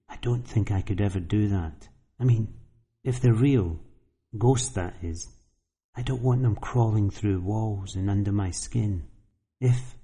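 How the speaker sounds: put-on quiet, hushed young woman; confiding softly, lightly voiced girl. Girl speaks of ghosts.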